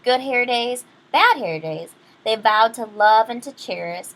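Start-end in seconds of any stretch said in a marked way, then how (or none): none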